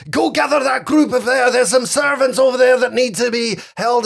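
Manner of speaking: shouting orders. Acted. Officer sends his men for the servants, shouted orders. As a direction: holler orders across the yard. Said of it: "you can hear him as he's going off".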